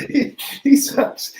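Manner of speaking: half-laughed he sucks, drawn out slowly; laughing mock verdict. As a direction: laugh your way through a fake concession. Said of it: "We'll switch sides mid-argument".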